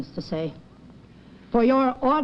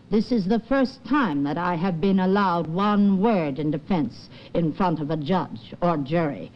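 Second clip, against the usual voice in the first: ordinary talk, nothing marked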